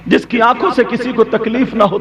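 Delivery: usual speaking voice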